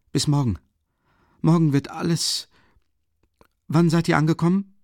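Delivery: halting, strained and high. A narrator's reading. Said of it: "sagte er mit stockender Stimme".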